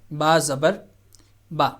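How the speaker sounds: ordinary talk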